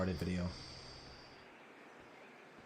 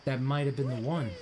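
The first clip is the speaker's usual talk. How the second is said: ordinary talk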